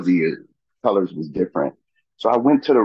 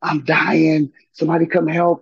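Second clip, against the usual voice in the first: high-pitched cry for help at normal volume; contained mock scream, high and pleading; imitated hollering for help; 9 semitones higher than usual. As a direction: re-enact a desperate call for help, pitched way up. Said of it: "I'm hollering".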